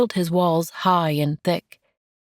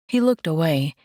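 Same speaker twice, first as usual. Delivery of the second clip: ordinary delivery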